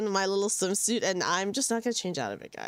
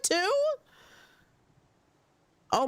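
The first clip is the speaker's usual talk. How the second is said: usual speaking voice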